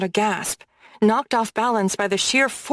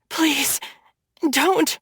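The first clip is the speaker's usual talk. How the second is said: weak, higher frightened plea; pleading; 6 semitones higher than usual. A narrator's reading. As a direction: beg for mercy, dazed and scared. Begging while beaten.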